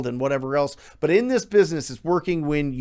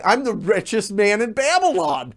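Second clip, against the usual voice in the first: cocky boasting; 7 semitones higher than usual. Retold or imitated. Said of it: "And we're like".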